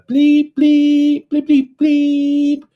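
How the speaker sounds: high, loud beeping noise like a camera alarm; imitated bleep. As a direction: beep it out high and steady like a warning tone. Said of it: "you'll hear some".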